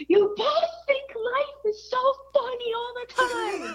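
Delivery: imitating an upset child's high-pitched wail; retold crying outburst. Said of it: "started crying. She was like".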